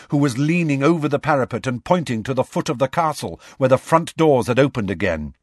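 ordinary delivery

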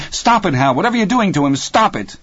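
high urgent plea; alarmed shout. A narrator's reading. Terry hammering on the door at Hal, alarmed shout. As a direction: call out urgently at a high pitch.

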